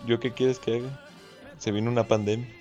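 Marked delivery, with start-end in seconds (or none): none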